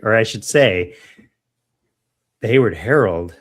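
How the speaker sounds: normal talking voice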